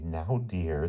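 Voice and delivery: calm him gently; soothing. A narrator's reading. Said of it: "soothed his wife".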